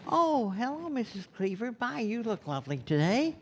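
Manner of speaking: put-on high, falsely sweet, sucking-up teen voice; Eddie Haskell impression. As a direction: play the smarmy neighbor kid buttering up a mom. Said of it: "Eddie was the neighbor kid".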